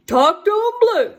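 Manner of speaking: high, loud call to the hounds; daddy hollering at the coon dogs. Retold or imitated. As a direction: holler it out across the woods.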